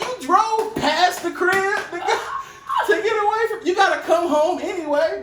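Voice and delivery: mock outraged yell. Ranting at his sister for driving past home, outraged yell. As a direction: yell it in disbelief at her.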